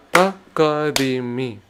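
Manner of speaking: ta, ka, di, mi run together in quick pairs; two syllables per count. Shown as a demonstration. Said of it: "Then I go two per count".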